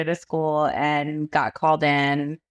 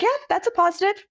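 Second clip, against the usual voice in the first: a high, chirpy put-on cheer; mock upbeat; 13 semitones higher, faster than usual. Mother answering her young daughter's joke, high mock cheer. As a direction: play along brightly with a joke.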